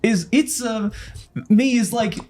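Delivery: high voice, slowly explaining the joke; imitating Mario. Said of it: "And he's like".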